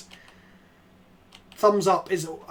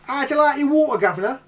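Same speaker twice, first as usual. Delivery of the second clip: loud, higher, hammed-up British; mock British accent; 7 semitones higher, 5 dB louder than usual. Streamer joking with Rob, exaggerated British accent. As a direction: play up a cheeky British accent. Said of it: "A proper British accent".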